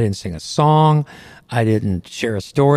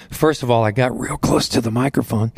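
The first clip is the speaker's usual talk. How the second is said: ordinary delivery, nothing marked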